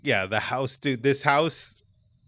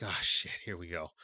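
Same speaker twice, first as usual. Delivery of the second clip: resigned dread; 5 semitones lower, 8 dB quieter than usual. Retold or imitated. Dread on seeing hallways.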